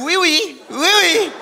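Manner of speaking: high-pitched repeated two-note squeal; imitated alarm. Comedian mimicking an audience member's alarm, high squealed imitation. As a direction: chirp it like a rising alarm tone. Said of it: "l'autre est comme".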